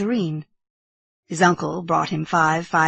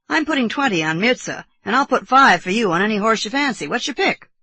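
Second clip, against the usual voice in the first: a hint of jovial uncle, higher; lightly voiced hearty uncle; 5 semitones higher, 6 dB louder than usual. Uncle inviting nephew to choose a horse, genial. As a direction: offer the treat briskly, lightly hearty.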